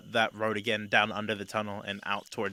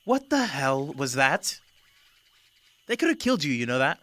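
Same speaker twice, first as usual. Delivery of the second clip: raised, higher-pitched telling-off; stern scolding; 4 semitones higher, 4 dB louder than usual. Acted. Greta scolding a companion who nearly got killed, sternly. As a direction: scold them sternly, pitch climbing with alarm. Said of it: "Greta kind of looks at you sternly".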